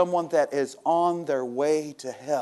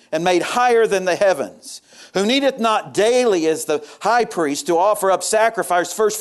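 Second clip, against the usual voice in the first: raised, emphatic scripture preaching; 4 semitones higher, 7 dB louder than usual. Acted. Preacher proclaiming the high priest to the congregation, emphatic preaching. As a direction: proclaim the verse from the pulpit.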